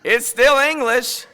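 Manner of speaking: high, loud, stretched-out punchline; comic exasperation. Acted. Preacher mocks his own useless slow English, comically exasperated.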